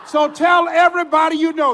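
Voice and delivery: rally the audience; rallying shout. Retold or imitated. DJ urging his listeners to spread the word, loud call.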